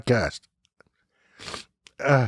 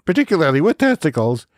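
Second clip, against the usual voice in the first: usual speaking voice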